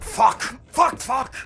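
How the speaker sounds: sharp loud curses, high; loud startled swearing; pained swearing. Acted. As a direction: yelp the curses out in sudden pain. Sudden pain, swearing.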